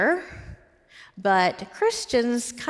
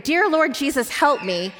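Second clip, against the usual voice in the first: higher, urgent plea from a nightmare; retold cry for help; 6 semitones higher, 4 dB louder than usual. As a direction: call out a prayer, mildly acted. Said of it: "she cried out to him".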